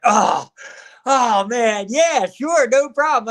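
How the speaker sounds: like fans thrilled to be asked; eager excited reply. Retold or imitated. Wrestlers' excited retold reply.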